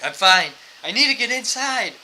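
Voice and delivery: mild mock yelling. Drunk man insisting he's fine, mild mock yell. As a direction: insist loudly, imitating an irritated drunk. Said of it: "he starts, like, yelling a little bit".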